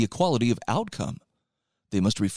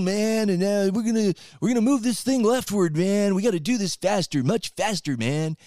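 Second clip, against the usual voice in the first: higher, imitating a stoned hippie radical; campus radical voice; 7 semitones higher than usual. Mocking campus radicals talking revolution.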